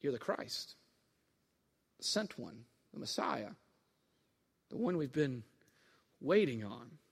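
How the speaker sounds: very low, soft and halting; low hushed reverence; hushed confession. Acted. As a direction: voice a quiet, awed declaration, with long pauses. Peter's solemn confession, voiced by preacher.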